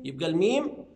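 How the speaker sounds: ordinary talk, nothing marked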